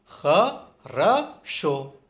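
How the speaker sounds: ख, र, शो split by clear pauses; very slow, separated ख... र... शो; syllable by syllable. Shown as a demonstration. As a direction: break खरशो into three syllables. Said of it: "इसमें तीन syllable हैं".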